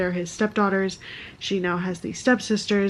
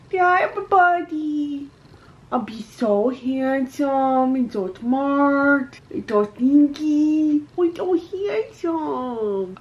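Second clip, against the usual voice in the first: cutesy put-on voice; 4 semitones higher, much slower than usual. Answers in a put-on voice.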